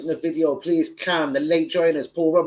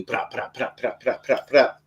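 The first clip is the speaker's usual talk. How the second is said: rapid-fire "pra" gunshot noises; mock gun salute; slower than usual. Imitated gun salute after a shout-out.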